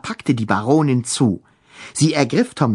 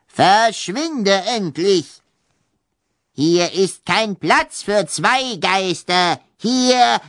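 angry ghost baroness; 7 semitones higher, much slower than usual. Acted. Ghost baroness orders him out.

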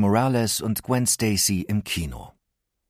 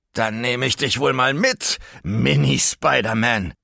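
smug Electro; 4 semitones higher, 3 dB louder than usual. Acted. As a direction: taunt the beaten hero. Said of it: "sagte Electro".

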